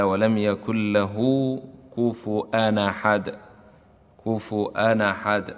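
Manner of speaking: slow tajweed reading of the verse; slow recitation. Shown as a demonstration. Teacher reciting كفؤا أحد. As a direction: recite each word slowly and clearly. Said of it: "كقوله تعالى".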